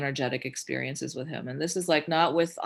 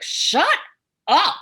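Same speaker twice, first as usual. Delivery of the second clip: high, loud outburst; exasperated protest; 10 semitones higher, 8 dB louder than usual. Retold or imitated. Arguing back at the message she kept hearing, exasperated shouting.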